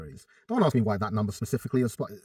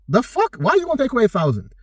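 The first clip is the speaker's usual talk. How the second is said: high, disbelieving outburst at a silly plan; incredulous; 10 semitones higher, 3 dB louder than usual. Retold or imitated. Coach mocking a client's thousand-calorie cut, incredulous and high. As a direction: pitch it way up in mock disbelief. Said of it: "And I'm just like".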